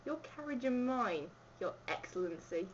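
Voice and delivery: put-on apologetic Cockney accent. Host apologising to His Excellency, Cockney accent. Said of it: "I'll do a Cockney accent".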